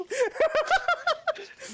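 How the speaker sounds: high burst of laughter. Real, not acted. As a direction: laugh out loud at your own joke. Laughing at own joke.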